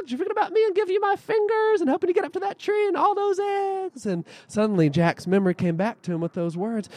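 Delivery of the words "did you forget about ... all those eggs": high hen voice; squawky hen speaking. Acted. Magic hen reminds Jack.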